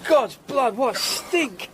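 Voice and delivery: high, loud burst of revulsion; disgusted outburst. Acted. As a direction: recoil from the stench out loud. Entering a reeking dead man's cottage.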